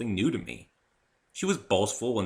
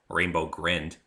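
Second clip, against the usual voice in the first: ordinary delivery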